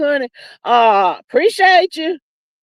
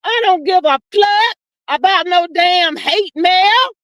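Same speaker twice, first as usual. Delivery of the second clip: slow, higher-pitched sassy retort; mock-defiant declaration, pitched up; 4 semitones higher than usual. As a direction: draw it out, imitating a defiant TV host. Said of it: "what Nancy Grace wanted the people to know".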